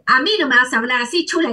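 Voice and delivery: fast, scolding put-down; harsh scolding. Retold or imitated. Husband scolding his wife, fast harsh put-down. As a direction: snap it at her like a scolding husband. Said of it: "él te podría decir".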